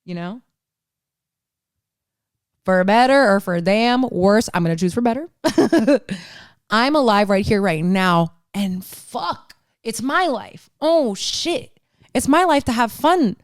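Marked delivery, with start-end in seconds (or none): none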